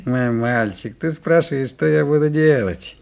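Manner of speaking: loud, swaggering bragging; loud boast. Acted. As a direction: boom it out like a proud show-off. Butcher boasting about his gun.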